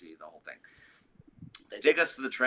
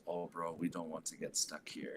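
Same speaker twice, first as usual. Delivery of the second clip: lightly imitated Cuban accent, lower and softer; a hint of a Cuban drummer's accent, low and soft; 5 semitones lower, 9 dB quieter than usual. Drummer on a late flight worrying about getting stuck, lightly imitated Cuban accent. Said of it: "Cuban accent".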